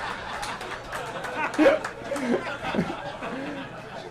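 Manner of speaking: long airy laugh; breathy laugh. Real, not acted. Laughs after drunk-driving joke.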